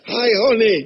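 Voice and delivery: a hint of a syrupy hello; sweet greeting. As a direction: lightly imitate a sugary greeting.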